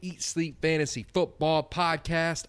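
high, upbeat show opener; energetic podcast welcome; podcast host intro. Acted.

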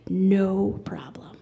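slow hushed whisper of agreement. Retold or imitated. Cashier whispering slowly to the customer that she'll help. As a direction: breathe it slowly, conspiratorially.

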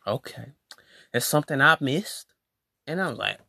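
usual speaking voice

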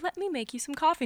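high, soft hostess politeness; mock-placid, lightly voiced housewife; imitating stoic obliviousness. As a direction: offer it softly, oblivious to the crisis. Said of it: "Stoic, just like".

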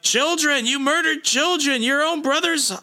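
high, horrified accusation; outraged accusation. Retold or imitated. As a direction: Brood condemns the murderer, voice way up. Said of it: "he's like".

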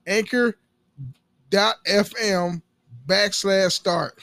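loud, deliberate web address plug; ad read. Acted. Podcaster giving listeners the address, loud ad read. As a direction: spell out the address slowly and loudly.

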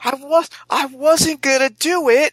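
very high, loud, pained whine; high-pitched wail of self-defense; squealed protest. Acted. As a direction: protest your innocence in a squeal. Player protests in character to hamster.

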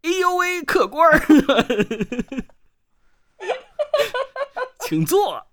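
put-on high, loud old teahouse keeper; Wang Zhanggui voice. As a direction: greet the guest like a fawning innkeeper. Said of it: "那你用一个那个茶馆里面的那个王掌柜的声音".

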